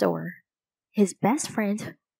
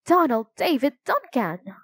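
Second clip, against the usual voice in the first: higher, firmly raised scolding voice; mild motherly yell; 4 semitones higher, 4 dB louder than usual. A narrator's reading. Mother scolding her running son, mildly yelled. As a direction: call out the full name as a reprimand. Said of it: "his mother yelled".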